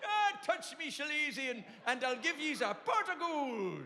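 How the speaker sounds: high, slow Irish leprechaun voice. Pretend. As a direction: play a cheeky Irish leprechaun. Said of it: "a leprechaun's walking stick".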